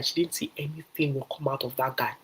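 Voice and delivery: ordinary delivery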